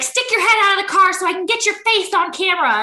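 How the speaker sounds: high-pitched mock outburst; freaking-out wife. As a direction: imitate a worked-up woman ordering someone around. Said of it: "freaking out".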